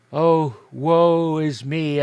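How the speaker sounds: slow, loud, wailing woe; ghostly lament. Acted. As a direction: moan it like a doomed spirit.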